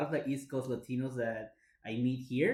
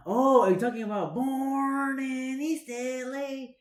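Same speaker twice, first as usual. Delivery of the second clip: mock voice; 10 semitones higher, 6 dB louder, slower than usual. Mock reply, high slow.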